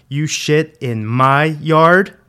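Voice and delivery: very loud, slow, high, rising question; furious accusation. A narrator's reading. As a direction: drag out each word in escalating rage. Dad repeats it, escalating.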